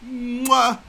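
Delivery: loud smacking kiss as Rose; a hammed-up kiss sound. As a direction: blow a big exaggerated kiss. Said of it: "here's my Rose impression".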